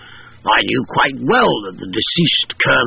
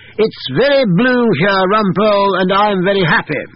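colonel via medium; 9 semitones higher, 3 dB louder than usual. A narrator's reading.

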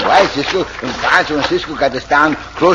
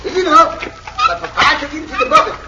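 calling up; 14 semitones higher than usual. Acted. Pancho calls up from the well.

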